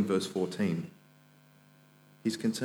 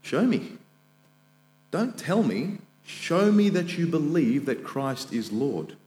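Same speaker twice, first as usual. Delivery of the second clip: James' retort; 5 semitones higher than usual. Retold or imitated. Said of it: "says, in effect".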